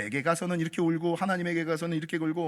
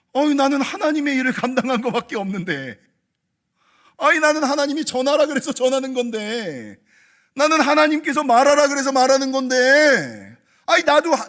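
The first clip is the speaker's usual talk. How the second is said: loud, higher pleading complaint; protesting prophet; 7 semitones higher, 11 dB louder than usual. Acted. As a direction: argue back, exasperated and loud. Jeremiah protesting his burden.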